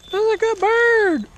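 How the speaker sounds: squeaky falsetto praise, slow; sing-song high praise for a good bird. Real, not acted. Praising the hawk on the glove.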